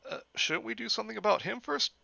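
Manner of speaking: put-on a timid kid asking; high kid voice. Kid asks the bus driver, high and hesitant.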